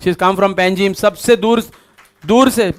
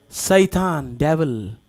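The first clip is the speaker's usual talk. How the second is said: grave low answer; 7 semitones lower, 7 dB quieter than usual. Real, not acted. Preacher answering who brings discouragement, grave and low. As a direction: voice dropped low and soft. Answers own question, voice dropped.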